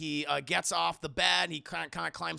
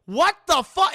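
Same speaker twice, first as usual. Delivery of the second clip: high, loud outburst; mock shock; 11 semitones higher, 12 dB louder than usual. Retold dad is baffled before the blow, in a loud shocked yell. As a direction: punch each word in alarm.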